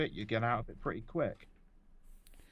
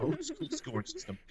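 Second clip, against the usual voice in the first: squeaky high-pitched echo; high mock voice. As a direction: repeat it in a silly high voice.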